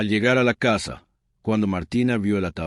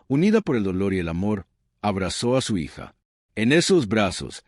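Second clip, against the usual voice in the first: ordinary delivery, nothing marked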